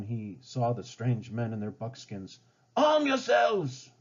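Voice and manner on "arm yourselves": much higher, slow commanding cry; rally the men in panic; high drawn-out call to arms; shouted order. Acted. Ratcliffe orders his men.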